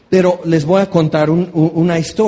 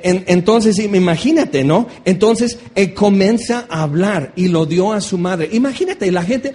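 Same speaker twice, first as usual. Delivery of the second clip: normal talking voice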